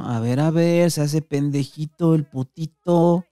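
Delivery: sing-song it to humiliate him; mocking taunt. The patas frisking Dani, slow mocking taunt.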